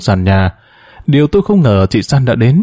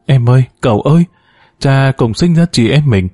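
plain reading voice

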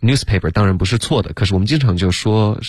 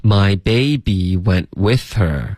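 slow, stretched model sentence; slow read-aloud practice; much slower than usual. Shown as a demonstration. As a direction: draw out each word for repeating. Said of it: "来读啊". (慢速拉长，领读示范)

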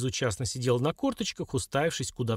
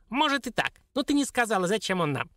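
sly dwarf; 8 semitones higher than usual. Acted. Dwarf replies.

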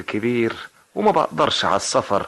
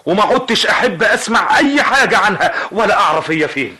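raised, louder angry declaration; angry outburst; 6 semitones higher, 4 dB louder than usual. Acted. Uncle refusing to hear of the mother, angry outburst. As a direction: burst out against her.

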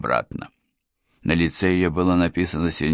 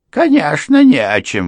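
much higher, loud exclaim; exclamation; 10 semitones higher, 8 dB louder than usual. A narrator's reading. Housekeeper eager to leave, exclaiming high and loud. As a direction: burst out in relief. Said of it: "воскликнула госпожа Крент".

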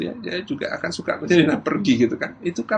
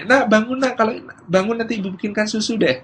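high-pitched wake-up call with a promise; bargaining mother; 7 semitones higher than usual. Acted. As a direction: rouse the child brightly with a conditional offer. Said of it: "model ibu pertama yang mengatakan". (ibu membangunkan dengan nada tinggi)

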